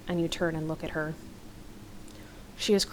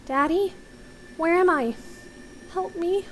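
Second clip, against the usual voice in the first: put-on high-pitched frightened little boy; small child voice, lost and pleading; scared, confused boy; 11 semitones higher than usual. A narrator's reading. Boy on a recorder calling his parents, scared and confused. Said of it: "The boy sounds scared and confused".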